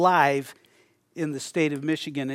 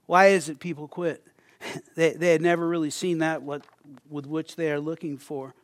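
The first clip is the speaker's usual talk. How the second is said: ordinary talk, nothing marked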